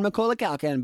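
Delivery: ordinary talk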